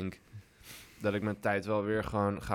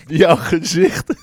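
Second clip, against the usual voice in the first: laughing; 8 semitones higher, 13 dB louder, much slower than usual. Real, not acted. Laughing at partner's reaction.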